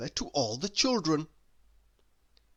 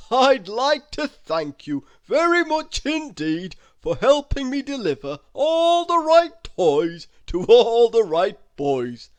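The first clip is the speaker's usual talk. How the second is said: higher, loud booming Santa thanks; grateful Santa; 6 semitones higher, 10 dB louder than usual. A narrator's reading. Santa thanking Mister Snow: loud grateful Santa voice. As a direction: thank him like a jolly old man. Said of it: "said Santa Claus".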